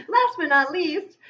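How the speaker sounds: high, loud, laughing announcement. Real, not acted. Reader introducing the last card, laughing. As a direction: say it through a burst of laughter.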